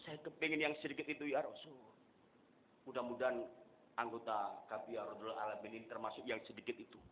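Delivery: usual speaking voice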